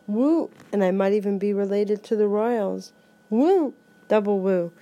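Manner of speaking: ordinary delivery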